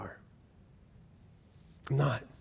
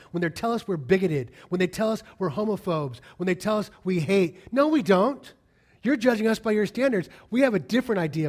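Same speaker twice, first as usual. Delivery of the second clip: rising, louder sermon push, higher on the protest; impassioned preaching; 6 semitones higher, 4 dB louder than usual. Acted. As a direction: preach it hard, building to a loud defiant "no". Preacher rising against critics.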